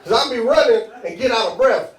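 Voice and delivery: ordinary delivery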